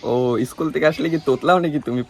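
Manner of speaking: ordinary talk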